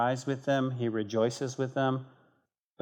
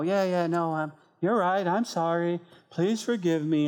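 higher-pitched, perfunctory sorry; glib mock apology; 5 semitones higher, 3 dB louder than usual. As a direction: apologise just to get it over with. Acts a glib, insincere apology.

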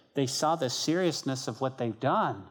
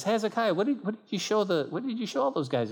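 higher-pitched, acted interrogation; questioning prophet; 8 semitones higher than usual. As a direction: lightly play a prophet demanding an account. Prophet questions Hezekiah in story.

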